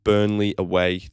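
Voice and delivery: ordinary delivery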